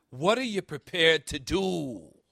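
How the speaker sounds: lightly voiced Irish cop accent, unhurried; light Irish brogue. Preacher quoting the film's Irish-American cop, light Irish brogue. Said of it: "Said with that Irish brogue".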